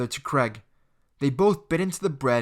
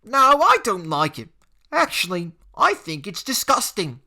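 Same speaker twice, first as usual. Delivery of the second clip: high, loud mock disgust; scornful schoolboy; sneering bully; 6 semitones higher, 6 dB louder than usual. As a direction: spit out the verdict. Jake rejecting sandwich.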